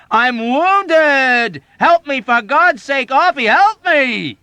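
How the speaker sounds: very high, loud, slow plea; frantic screaming. Acted. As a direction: beg for your life. Yossarian wounded, shouting.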